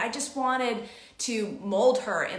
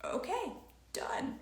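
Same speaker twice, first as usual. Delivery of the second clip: whispered okay; 12 semitones higher, 10 dB quieter than usual. Retold or imitated. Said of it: "And I was like".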